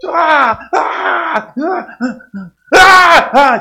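high wailing cries, imitating a child; imitated crying screams. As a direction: wail high like a screaming girl. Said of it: "she'd start screaming again".

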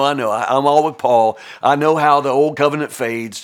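usual speaking voice

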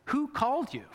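rising jab of a question; pointed question. Retold or imitated. Preacher challenging a naturalist, pointed and incredulous. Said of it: "I say".

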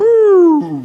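high, loud hooting "ooh"; loud imitated "ooh". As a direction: let out a high, sustained ooh. Imitated noise of things going downhill.

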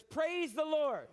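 slow proclaiming. Shown as a demonstration. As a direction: proclaim it slowly for all to repeat. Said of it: "Everybody say that".

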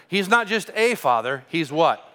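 usual speaking voice

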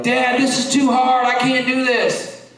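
son whining that the work is too hard; whiny kid. Retold or imitated. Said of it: "and he'd be like".

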